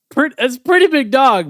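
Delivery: high, loud burst; excited. Real, not acted. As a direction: blurt it out with delight. Laughing defense of the punchline.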